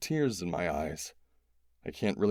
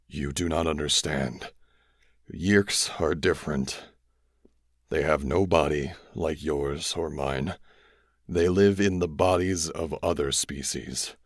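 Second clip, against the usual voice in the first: dying alien; 4 semitones lower than usual. A narrator's reading. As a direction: drag it out, grave and tired.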